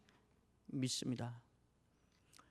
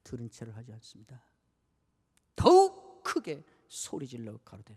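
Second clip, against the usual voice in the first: usual speaking voice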